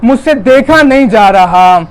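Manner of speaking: high, loud wailing preacher's lament, slowed; lamenting sermon. Acted. Preacher recounts Karbala's grief to mourners, high loud wailing lament. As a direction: cry the tragedy out to the mourners. Masaib recitation at full force.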